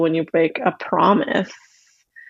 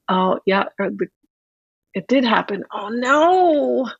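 usual speaking voice

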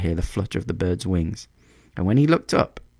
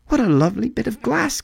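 put-on high-pitched little girl exclamation; delighted cry; faster than usual. A narrator's reading. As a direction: marvel aloud, pitched right up. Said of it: "cried the girl".

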